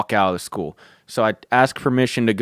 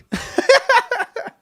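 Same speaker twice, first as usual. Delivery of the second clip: squeaky high-pitched laugh; high giggle. Real, not acted.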